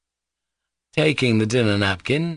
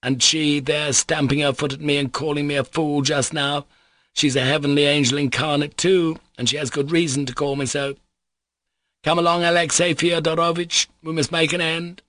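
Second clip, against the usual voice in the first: high-pitched, fussy, voluble man; excitable and ceremonious, pitch held up; effusive captain; 7 semitones higher than usual. Acted. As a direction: gush with elaborate courtesy. Captain continues his effusive speech.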